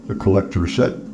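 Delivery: ordinary delivery, nothing marked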